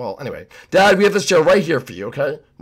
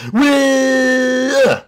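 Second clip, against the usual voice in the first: long, high squeal of glee; gleeful whee; 9 semitones higher, 3 dB louder than usual. Acted. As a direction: hold a high, joyful "whee" all the way down. Launching off on the stunt again.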